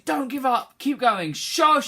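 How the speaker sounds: high, rising to a shout on Shosholoza; cheering on a friend who gave up; encouraging a friend. Shown as a demonstration. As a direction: urge a fallen friend to keep going. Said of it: "Your friend has fallen over and is giving up".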